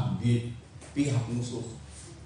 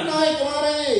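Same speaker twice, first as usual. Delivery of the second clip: high, loud shouted summons; calling out; 10 semitones higher, 5 dB louder than usual. Acted. Philistine guards cry out to Jonathan, loud call. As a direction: shout to the men below. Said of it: "berseru kepada Yonatan".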